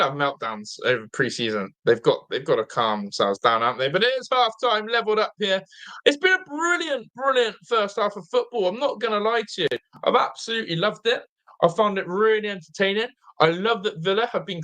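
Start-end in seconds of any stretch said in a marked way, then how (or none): 6.0-8.7 excited praise. Real, not acted. Excited half-time verdict.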